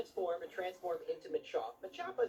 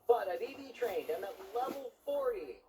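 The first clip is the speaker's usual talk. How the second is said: ordinary talk, nothing marked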